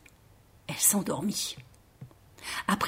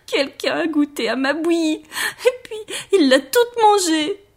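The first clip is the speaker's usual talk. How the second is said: high sobbing little-bear voice; crying Baby Bear; 7 semitones higher, 3 dB louder than usual. A narrator's reading. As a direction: sob first, then wail high, dragging out the end. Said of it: "Bébé ours se remit à pleurer". (bébé ours qui pleurniche, voix aiguë)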